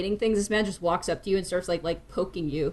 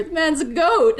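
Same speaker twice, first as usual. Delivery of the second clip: raised, loud voice of a joking man; amused exclamation; 8 semitones higher, 5 dB louder than usual. Acted. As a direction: call out the joke, pitched up. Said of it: "the man just laughs and goes".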